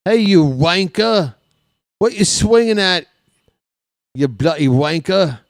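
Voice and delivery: high, loud British heckling; loud mock British taunt from the stands; British accent heckle. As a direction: jeer at the batter like a rowdy British fan. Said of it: "heckle Jazz in a British accent".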